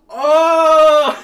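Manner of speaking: a sudden loud high "Oh!"; hammed-up dawning shock; mock realization. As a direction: blurt it out as the penny drops.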